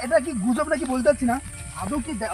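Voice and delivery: usual speaking voice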